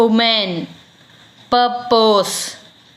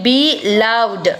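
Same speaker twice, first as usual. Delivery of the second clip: ordinary talk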